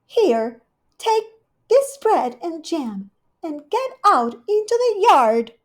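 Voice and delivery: high, loud shooing; Rivy cross, high and loud; cross order. Acted. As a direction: shoo the bird out crossly. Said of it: "losing her temper".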